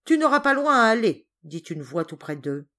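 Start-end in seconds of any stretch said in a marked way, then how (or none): 0.0-1.3 loud, raised-pitch retort; sudden stern interruption. Acted. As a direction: cut the boy short with authority. Father interrupts from the bushes.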